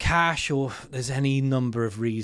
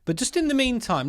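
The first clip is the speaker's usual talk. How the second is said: ordinary talk, nothing marked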